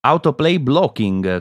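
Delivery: exaggerated mock-English reading, slow; fake English. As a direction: ham up a fake English accent. Sto facendo finta di leggere un finto inglese.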